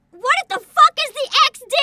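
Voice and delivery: high, very loud indignant outburst; outraged yell. Real, not acted. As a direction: yell at chat in disbelief. Outburst at a chat message.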